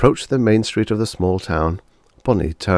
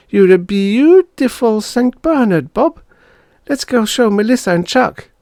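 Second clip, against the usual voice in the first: put-on very high, louder gushing girl; a little girl thrilled; excited girl; 6 dB louder than usual. Bonnie admiring the dog, very high voice.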